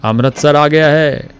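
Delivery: slow, high, loud announcement; excited shout. A narrator's reading. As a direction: stretch it out, overjoyed. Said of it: "उसने फिर से कहा".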